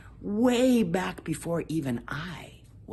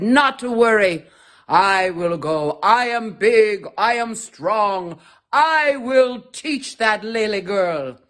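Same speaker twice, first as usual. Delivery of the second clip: loud, slow bragging; big and strong, proud of it; the elephant boasts loudly and slowly; boastful elephant; 8 dB louder, slower than usual. Acted.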